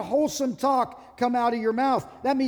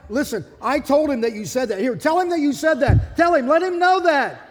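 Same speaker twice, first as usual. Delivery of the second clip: mock heated demand, building louder and higher; arguing caller; 4 semitones higher, 6 dB louder than usual. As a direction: push your spouse to confess to the pastor. Acted callers quarrelling on phone.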